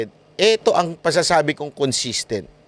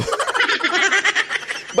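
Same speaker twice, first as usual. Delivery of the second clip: high cackle. Real, not acted. Laughs at own joke about Ben.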